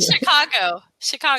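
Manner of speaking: light demo of a Chicago accent; playful Chicago accent imitation. Shown as a demonstration. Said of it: "that's the accent".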